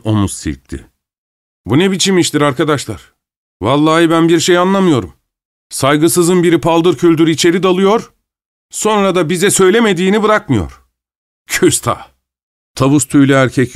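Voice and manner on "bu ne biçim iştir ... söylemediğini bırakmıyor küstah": lightly voiced, higher-pitched indignant man; bewildered, affronted complaint; offended bank cashier. A narrator's reading. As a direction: complain to your friends in mild outrage.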